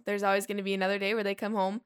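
ordinary delivery

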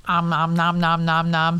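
chomping nom nom; playful nom nom sounds; om nom eating noise. Pretend. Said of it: "eating sound".

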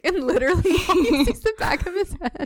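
laughing out the words, voice high and bright; cracking up mid-sentence. Real, not acted. Laughing while telling.

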